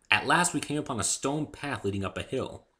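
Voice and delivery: ordinary delivery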